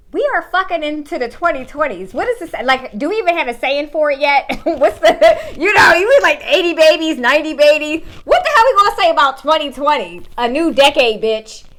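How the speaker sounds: loud, laughing rant about the new decade; excited. Real, not acted. Talking to viewers about the new decade, loud and laughing.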